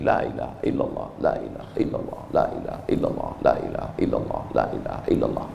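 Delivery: soft unvoiced whisper of la ilaha illallah; whispered dhikr. Shown as a demonstration. Preacher shows the congregation a whispered tasbih. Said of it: "ambil tasbih".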